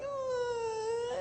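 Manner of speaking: a high, crying "oh" at the bubbles; dismayed little cry. Retold or imitated. A wife seeing washer foam everywhere, a high crying oh. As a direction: gasp it out high, startled and upset. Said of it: "And I was like".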